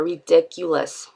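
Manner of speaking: RIDICULOUS said slowly and deliberately, syllable by syllable; emphasized word. Real, not acted. Vlogger pointing out her own word choice, RIDICULOUS slow and emphatic. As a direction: repeat "ridiculous", drawn out for emphasis. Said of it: "I'm emphasizing a word in there".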